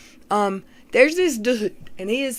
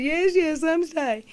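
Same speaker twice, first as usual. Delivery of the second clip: mock girlfriend; 8 semitones higher, slower than usual. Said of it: "she said".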